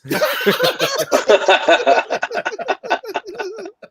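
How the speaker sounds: high howling laugh; group bursts out laughing at the story; loud laughter. Real, not acted. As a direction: break down laughing. Friends crack up again.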